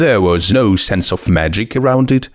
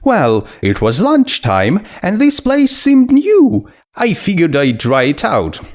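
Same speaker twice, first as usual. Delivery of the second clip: a much higher, bubbly voice, quickly sorry; high, eager skeleton girl; 10 semitones higher than usual. Acted. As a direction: gush with sudden friendly excitement. Skeleton explains lunch visit.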